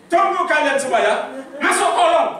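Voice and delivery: put-on loud, high command to the children; imitated father's order. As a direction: order them off like a stern dad, acted. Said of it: "papi saya bilang gini". (perintah ayah yang lantang, ditirukan)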